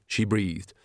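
usual speaking voice

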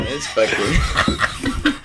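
slow words dissolving into laughter; laughing too hard to tell it. Real, not acted. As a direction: break up laughing. Laughing breakdown.

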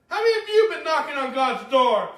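loud, rising preacher's challenge; preaching at full force. Real, not acted. Preacher calling out to his congregation, loud and fervent.

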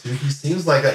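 ordinary talk, nothing marked

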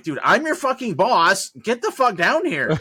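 high, loud retold order; exasperated boss.

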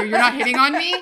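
startled, laughing question; horrified. Retold or imitated. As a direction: ask it horrified.

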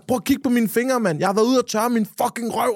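indignant, high, mock-furious retort; retold fury. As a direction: act out outraged self-defence. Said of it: "jeg er rasende".

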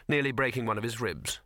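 ordinary delivery

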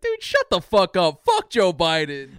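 loud, drawn-out angry retort; exasperated outburst. Retold or imitated. Acted angry retort to slogan.

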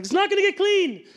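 high, loud mock-frustrated yell; exasperated cry. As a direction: complain loudly, pitch jumping right up. Retold frustration scrubbing a dirty floor.

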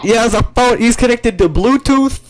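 loud alarm. Acted. Hosts over the blaring speaker, loud alarm. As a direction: shout over the noise in alarm.

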